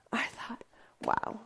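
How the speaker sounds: whispered in wonder; awed whisper. Real, not acted. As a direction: breathe it out, awestruck. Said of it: "awed whisper".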